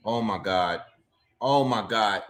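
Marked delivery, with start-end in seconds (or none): none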